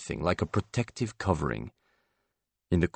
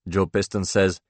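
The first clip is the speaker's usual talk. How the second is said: ordinary delivery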